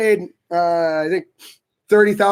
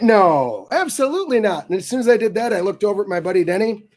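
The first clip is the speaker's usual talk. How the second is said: ordinary talk, nothing marked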